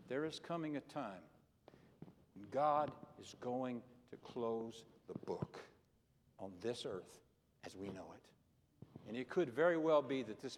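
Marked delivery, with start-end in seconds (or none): 2.3-8.2 solemn hush. Acted. Solemn warning of the end.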